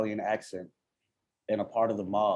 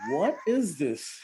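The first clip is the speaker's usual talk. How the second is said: slow, high bewildered "What is this?"; drawn out in disbelief; baffled mock question; 7 semitones higher, slower than usual. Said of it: "I'm like".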